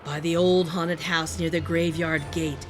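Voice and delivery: ordinary delivery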